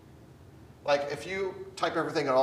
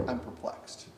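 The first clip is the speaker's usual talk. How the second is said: whispered, softer, breathy and hushed; whispered perplexity; 6 dB quieter than usual. Acted. Teacher echoing Paul's perplexed words in a whisper. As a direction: breathe it out like a private worry.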